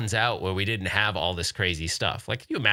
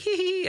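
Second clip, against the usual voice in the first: mock girlish giggle. Daughter's giggle.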